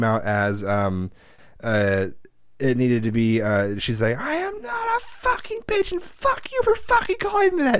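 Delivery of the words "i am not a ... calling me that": very high, furious screech of the sister. Retold or imitated. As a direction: shriek it like an outraged teenage sister, slowed. Sister furious, mishearing "bitch.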